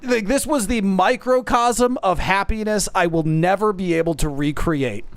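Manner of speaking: normal talking voice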